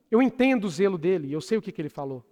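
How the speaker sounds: normal talking voice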